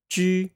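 Ju held high and level with rounded lips; pinyin ju first tone. Shown as a demonstration. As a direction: say Ju on one high flat pitch. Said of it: "One more time".

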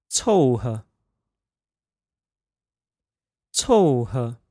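凑合 said còu he, falling then light neutral; 凑合 with a fourth tone and an unstressed he; practicing tones. Shown as a demonstration. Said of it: "fourth tone, neutral tone pair".

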